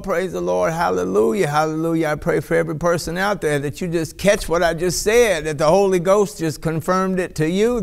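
normal talking voice

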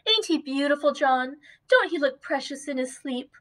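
put-on a doting mother admiring her sleeping baby; doting young wife. Dot's voice in story.

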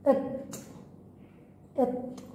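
voiceless إط; whispered letter sound. Shown as a demonstration. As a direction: breathe the إط without voice. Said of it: "أنطق حرف الإط".

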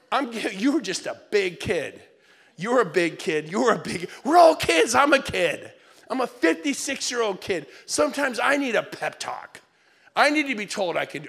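put-on rally a kid like a coach; loud pep talk. Dad giving his sad daughter a loud pep talk. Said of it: "What do you say to your kids?".

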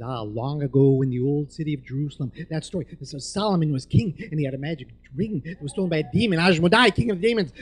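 loud, building storyteller's excitement; unstoppable storytelling. Retold or imitated. Patient finding his voice in surgery, loud excited storytelling. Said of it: "I couldn't stop talking".

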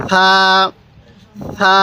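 long, gentle lisped "th" opening সা; soft সা. Shown as a demonstration. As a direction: say সা softly with the tongue at the teeth, like "th" in "thin". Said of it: "সাটা নরম করে".

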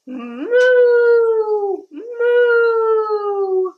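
high, loud playful moo; mock cow mooing for children. Shown as a demonstration. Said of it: "when I say moo".

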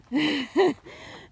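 laughing through the tears; choked-up little laugh; tearful laugh. Real, not acted. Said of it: "teardrops in my eyelids".

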